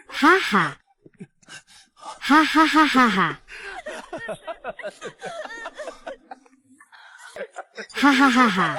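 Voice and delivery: slow, gloating evil laugh; evil laughter. Acted. Boss gloating over the trapped employee, evil laughter. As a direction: laugh like a predator savoring his catch. Boss traps woman in restroom.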